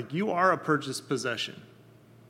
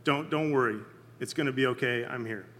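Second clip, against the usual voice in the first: put-on soothing a child after a nightmare, growing softer; softly voiced parental reassurance; calming parent; 3 semitones lower, 5 dB quieter than usual. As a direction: settle a scared child, voice sinking low and quiet. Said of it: "Just their voice really calmed things".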